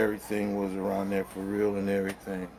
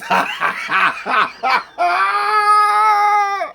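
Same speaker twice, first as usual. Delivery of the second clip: high shrieking laugh; wild laughter; 11 dB louder than usual. Real, not acted. Friends hear the Tony quote, wild laughter. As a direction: laugh until near crying.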